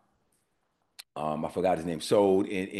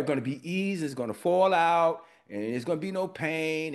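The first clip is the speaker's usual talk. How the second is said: higher-pitched retelling of her prayer; voicing her prayer, pitched up; imitated friend's prayer; 6 semitones higher than usual. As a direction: lightly mimic a friend praying aloud. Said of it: "that was her prayer".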